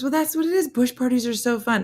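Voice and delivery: high-pitched voice for the lady; high mock insisting voice; imitated defensive lady. Retold lady defending bush parties.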